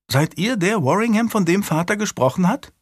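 put-on high-pitched child's question; small girl eagerly questioning a lord; high, inquisitive child voice; curious little girl. A narrator's reading.

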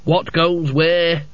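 slow, faltering challenge; nervous guard. A narrator's reading. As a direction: stumble over the challenge. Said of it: "he croaked".

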